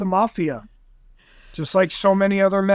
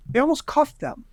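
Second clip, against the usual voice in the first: normal talking voice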